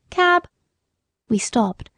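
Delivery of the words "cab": high hailing call. A narrator's reading. Porter at the hospital door, calling out. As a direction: call it out on a raised note. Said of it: "the porter called out".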